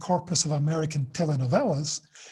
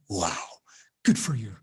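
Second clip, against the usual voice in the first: much lower, dry sarcastic "wow, good for you"; 8 semitones lower than usual. Pretend. A listener brushing off a happy story, sarcastic. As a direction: sound unimpressed by their happiness.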